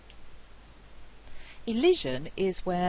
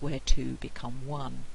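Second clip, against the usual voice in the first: usual speaking voice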